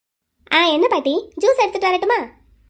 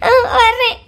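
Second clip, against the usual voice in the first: crying reply; 4 semitones higher, 6 dB louder than usual. Acted. Wife rushing to husband, crying.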